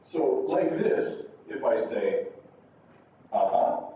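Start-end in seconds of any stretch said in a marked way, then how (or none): 3.3-3.8 "Uh-huh" low, lifting at the end; rising intonation. Shown as a demonstration. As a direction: start "Uh-huh" low and rise. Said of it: "you mark it as rising".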